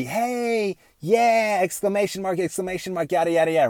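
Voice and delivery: high, gushing fake-friendly greeting; lightly hammed-up sugary politeness; mock extra polite. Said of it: "to be extra polite to".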